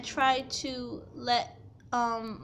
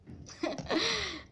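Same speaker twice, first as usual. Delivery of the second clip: short high laugh; real laugh; 8 semitones higher, 6 dB quieter than usual. Real, not acted. Teen laughing at her stepmom's accusation, real laugh.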